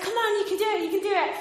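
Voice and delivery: high encouraging cheer. Retold or imitated. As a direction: cheer her on from across the gap. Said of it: "I was like".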